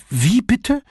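shocked, stunned question; stammered shock. Acted. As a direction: caught off guard, voice jumps up. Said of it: "stammelte sie".

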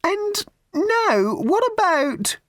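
very high, slow, sugary raffle-lady voice; a prim, simpering old charity lady pushing tickets; high twittering church-fete lady; raffle woman. Acted. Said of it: "The woman turned to Fenny".

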